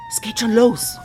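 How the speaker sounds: high, loud excited announcement; thrilled the show is starting. Acted. Telling the friend the radio show starts now, loud and thrilled.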